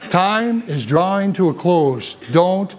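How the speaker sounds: slow, weighty, every word set apart; slow earnest plea not to drop the ball; slow solemn warning. Real, not acted. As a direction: let the warning sink in slowly. Solemn closing exhortation.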